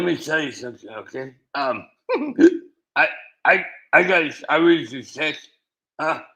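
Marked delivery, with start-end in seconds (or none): none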